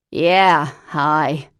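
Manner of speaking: loud, put-on voice of an old chain smoker; Lavinia's horrible voice. Cousin Lavinia phoning Parker, loud put-on smoker's voice. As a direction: bark it out like a lifelong smoker. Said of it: "said a horrible voice".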